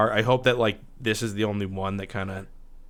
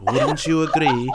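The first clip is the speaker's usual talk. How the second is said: slow, louder mock German question; mock German accent; 4 semitones higher, 5 dB louder, slower than usual. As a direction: ask it like a pompous German. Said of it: "talking like that".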